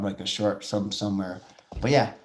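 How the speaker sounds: normal talking voice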